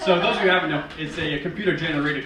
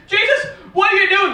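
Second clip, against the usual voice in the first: loud, high mother's shout; imitated scolding mother; Mary; 14 semitones higher, 8 dB louder than usual. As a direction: yell at your child in alarm. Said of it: "Mary comes out and says".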